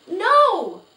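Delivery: high, loud desperate cry. Acted. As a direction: cry out to stop the whip. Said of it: "cried Felicity".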